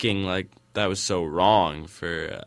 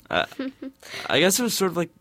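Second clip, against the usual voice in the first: usual speaking voice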